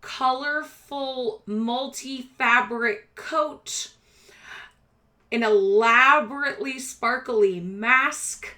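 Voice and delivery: slow, deliberate scene setting; game master description. A narrator's reading. Game master describing a gaudy rat boy, slow measured narration. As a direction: lay out each detail slowly, with pauses.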